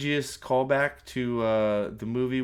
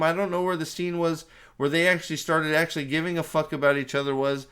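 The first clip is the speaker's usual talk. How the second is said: ordinary delivery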